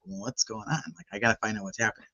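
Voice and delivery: hushed, low-volume curiosity; nosy aside. Retold or imitated. Neighbor wondering about police on her street, hushed nosy aside. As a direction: say it softly, as if to yourself.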